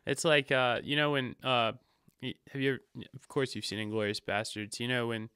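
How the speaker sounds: normal talking voice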